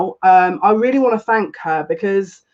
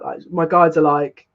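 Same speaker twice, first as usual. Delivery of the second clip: ordinary talk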